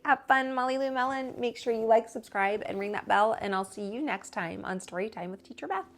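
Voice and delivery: usual speaking voice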